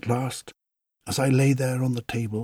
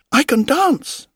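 gleeful boast; 8 dB louder than usual. A narrator's reading. Puppet boasts.

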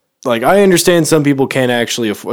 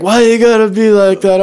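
higher, louder whiny plea; whiny mock beggar; 10 semitones higher, 7 dB louder than usual. Storyteller mimicking the homeless woman's complaint, whiny and high. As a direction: mimic her whining, pitched up. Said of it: "She's like".

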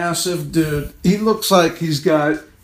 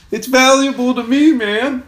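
hurt protest; 11 semitones higher than usual. Real, not acted. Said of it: "like I'm accusing him".

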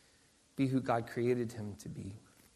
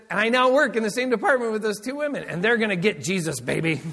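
higher, louder, gleeful boast; triumphant; 7 semitones higher, 8 dB louder than usual. Retold or imitated. Friend boasting to the pastor about his new mission, gleeful. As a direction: crow about the plan with a laugh.

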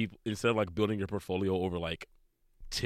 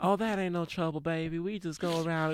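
put-on high, lilting Southern belle accent; put-on Southern accent, pitched well up; soft-spoken Southern belle; 9 semitones higher than usual. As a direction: play a sweet Southern belle. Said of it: "the soft-spoken Southern belle".

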